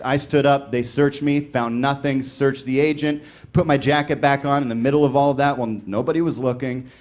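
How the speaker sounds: ordinary delivery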